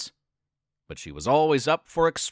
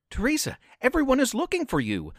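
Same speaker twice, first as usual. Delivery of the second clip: higher, quick, begging his friend; pleading; 7 semitones higher, faster than usual. Acted. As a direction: plead with her to come home. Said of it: "Donnie pleaded".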